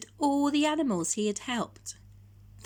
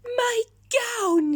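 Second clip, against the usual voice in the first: yelled; 7 dB louder than usual. Acted. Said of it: "he yelled".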